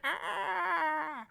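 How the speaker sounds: high, long-held wail; sustained high-pitched cry; imitated old man's cry. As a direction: imitate a man wailing as he runs past. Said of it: "just going like".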